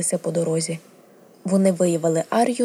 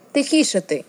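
short, higher-pitched scolding; sharp command to settle down; snapping; 6 semitones higher than usual. A narrator's reading. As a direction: snap at a restless animal to hush. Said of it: "гаркнула вона на Німерію".

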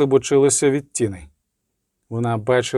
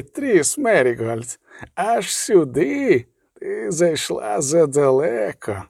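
slow, drawn-out sinister taunt, pitched up; cold malevolent voice; 4 semitones higher, slower than usual. A narrator's reading. Inhuman voice taunting the sorceress who followed the girl, cold and malevolent. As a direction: stretch the words with cruel mockery. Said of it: "Голос став металевим, холодним і зловорожим".

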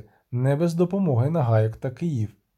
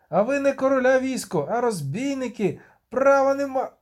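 much higher outraged cry; shouted; 9 semitones higher than usual. Acted. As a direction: yell defiance at the troop. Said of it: "крикнув". (дядько з чуприною кричить на вояків)

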